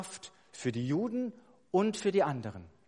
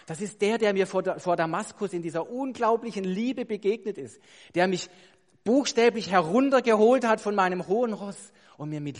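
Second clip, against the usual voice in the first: loud, higher-pitched impassioned declaration; fervent testimony; 5 semitones higher, 8 dB louder than usual. Acted. Preacher as Paul telling of his Damascus encounter, fervent. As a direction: build to a ringing, heartfelt proclamation.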